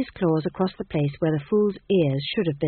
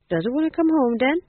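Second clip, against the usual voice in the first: high coaxing voice to a cat; hopeful coaxing; 7 semitones higher than usual. A narrator's reading. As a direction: coax the cat hopefully, pitched up. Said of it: "said the fool hopefully".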